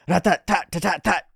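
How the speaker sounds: rapid vocal rat-a-tat; imitated gunfire. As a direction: rattle off a gun burst. Imitating gunfire in manga action.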